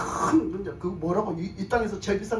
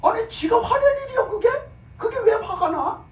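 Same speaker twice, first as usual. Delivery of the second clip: high, loud, incredulous outburst; exasperated rhetorical question from the pulpit; indignant challenge; 12 semitones higher, 5 dB louder than usual. Real, not acted. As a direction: scold in disbelief, pitched way up. Indignant challenge to Judas's anger.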